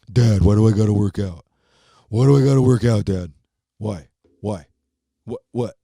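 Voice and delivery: a hint of a whining teen son; mock-whine like a sulky kid; whiny teenager. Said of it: "Being a teenager".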